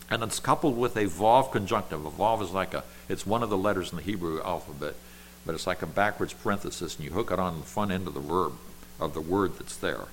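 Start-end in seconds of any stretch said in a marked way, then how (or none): none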